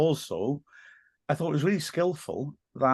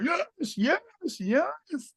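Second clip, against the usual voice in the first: drawn-out, high celebratory mock scream; retold scream; much slower than usual. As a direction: imitate screaming yes in a high, held-back voice. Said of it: "just screaming".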